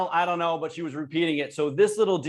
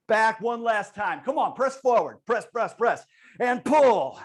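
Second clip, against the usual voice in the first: pumped-up coaching; 5 semitones higher, slower than usual. Real, not acted. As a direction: drive the class through every rep. Coach calling out exercise cues.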